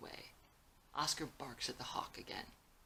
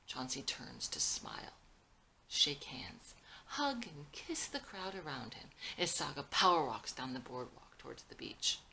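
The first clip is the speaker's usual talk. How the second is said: ordinary delivery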